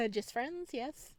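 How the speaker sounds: ordinary delivery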